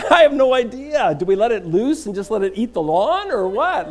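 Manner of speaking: high, loud, at a loss; exasperated bafflement. Acted. Husband at a loss what to do with goat, exasperated bafflement. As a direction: throw out questions helplessly. Helpless reply to wife about goat.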